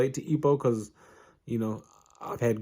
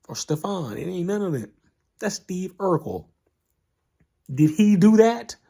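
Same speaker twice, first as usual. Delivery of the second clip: mocking coach; 10 semitones higher, 4 dB louder than usual. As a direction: ridicule the kid as a nerd, punching the last line. Coach mocking Ippo as Urkel.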